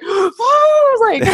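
high cry-out, pitch shooting up; tearful high cry while retelling the award photo; reliving crying. Retold or imitated. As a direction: break into a tearful wail. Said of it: "turning my head and crying some more".

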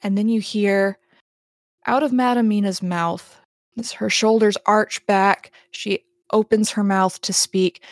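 ordinary delivery

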